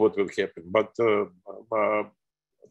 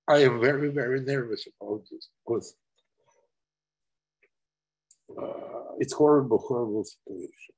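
trembling with emotion. Real, not acted. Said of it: "sorry for my emotional on this".

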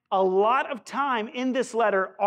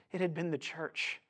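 ordinary talk, nothing marked

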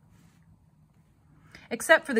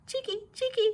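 high chirping scold; scolding swallows; 13 semitones higher, 4 dB quieter, faster than usual. A narrator's reading. Swallows scold the child entering the barn, high and chirpy.

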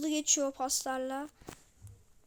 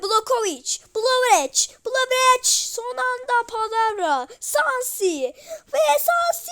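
rising, louder play-by-play call; excited football commentary; 9 semitones higher, 6 dB louder than usual. Pretend. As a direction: build the attack like a live commentator. Kids commentating a football game. (heyecanlı maç anlatımı)

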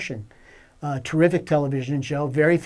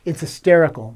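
normal talking voice

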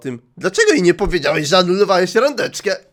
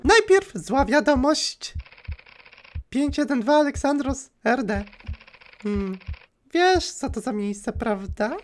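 creepy caller voice; 8 semitones higher, 4 dB quieter than usual. Acted. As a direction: purr it like a creepy stalker. Głos stalkerki. (wysoki, cichy, złowieszczy głos dzwoniącej)

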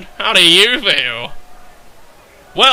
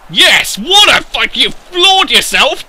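loud, high cheer; excited outburst; 7 semitones higher, 5 dB louder than usual. Real, not acted. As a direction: cry out at the play, voice pitched up. Reacting to live match action.

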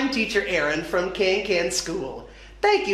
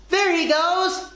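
high, excited storybook yell; mock yell; 8 semitones higher than usual. A narrator's reading. Tess sees the gecko again, high excited yell. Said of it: "Tess yelled".

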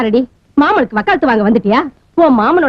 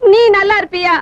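tearful, raised pitch, voice cracking; crying curse; 5 semitones higher than usual. Acted. Mother cursing the uncle over her daughter, crying. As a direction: curse him through tears.

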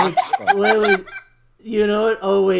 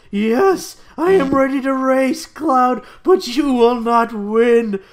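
confident; 4 semitones higher, 5 dB louder than usual. Acted. Vincent to Cloud before the race, a confident boast. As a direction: declare victory before the race. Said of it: "Vincent says confidently".

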